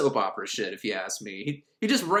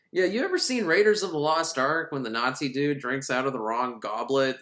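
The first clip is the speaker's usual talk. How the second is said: normal talking voice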